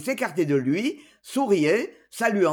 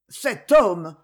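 ordinary delivery

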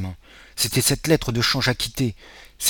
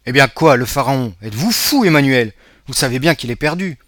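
exasperated retort; 3 semitones higher, 8 dB louder than usual. Acted. Father rebukes excited Emmanuel.

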